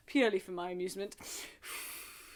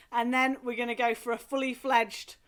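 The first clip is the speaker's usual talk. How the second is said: ordinary delivery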